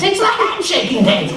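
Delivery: very high, strained repeat; strained squeal. Acted. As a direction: squeal it again through pain. Repeated in pain.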